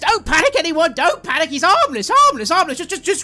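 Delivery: high, loud frantic plea; frantic shout. Acted. Top-hatted man shouting to tavern not to panic, loud. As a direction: panic while telling others not to.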